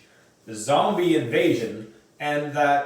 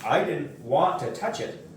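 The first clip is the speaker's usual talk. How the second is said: ordinary delivery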